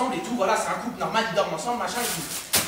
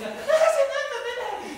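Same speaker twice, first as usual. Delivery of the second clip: high mock voice; 13 semitones higher than usual. Admiring the palace, very high mock voice. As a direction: swoon at the palace in a squeak. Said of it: "je dis".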